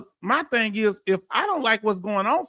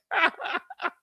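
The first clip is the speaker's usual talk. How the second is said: laugh; 9 semitones higher, 5 dB quieter than usual. Real, not acted. Laughs after teasing absent viewers.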